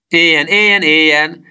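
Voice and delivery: high, loud, jubilant cheering; shouted cheer. A narrator's reading. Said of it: "kiáltotta Kalapocska". (magas hangú, hangos éljenzés)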